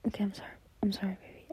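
ordinary talk, nothing marked

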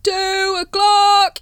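very high, loud, slow drawn-out call; yelled. Acted. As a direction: bellow it like a night watchman. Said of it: "he yelled".

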